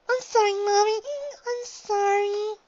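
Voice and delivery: put-on high, loud child's sob, dragged out; sobbing. A narrator's reading. Guilty little filly sobbing to her mother, high and loud. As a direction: sob out an apology. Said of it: "she sobbed".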